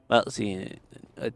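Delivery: ordinary talk, nothing marked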